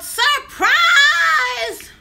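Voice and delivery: high, loud party scream; screamed surprise. Retold or imitated. Party guests greet her as she walks in, screamed surprise. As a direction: shout it out like a whole crowd. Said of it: "they all screamed out".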